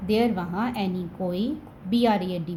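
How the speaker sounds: usual speaking voice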